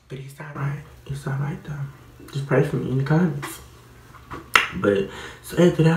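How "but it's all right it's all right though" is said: soft whispered reassurance; whispering. Real, not acted. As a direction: whisper it to yourself. Soft aside to viewers.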